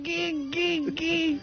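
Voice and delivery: goofy gee laugh. Real, not acted. Laughing after the joke.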